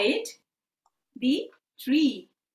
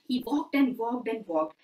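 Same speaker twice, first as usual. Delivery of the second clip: ordinary delivery, nothing marked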